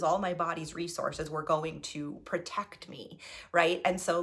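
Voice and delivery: normal talking voice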